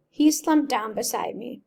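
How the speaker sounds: usual speaking voice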